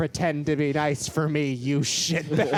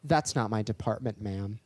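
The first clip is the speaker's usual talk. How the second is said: low, dry dismissal; deadpan, drop it low and quiet; flat brush-off; 7 semitones lower, 5 dB quieter than usual. Retold or imitated. Cashier brushing off a demanding customer, deadpan. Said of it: "And I'm like".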